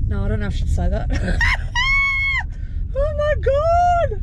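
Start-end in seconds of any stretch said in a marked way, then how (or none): 1.1-2.9 high, squealing burst of laughter; shrieking laugh. Real, not acted. Bursts out laughing at own joke. 3.0-4.2 high, slow oh my God through laughter; laughing exclaim. Real, not acted. After laughing fit.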